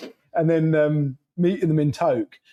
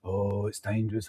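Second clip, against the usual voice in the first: mocking low murmur; 7 semitones lower, 9 dB quieter than usual. Mocking people's warnings.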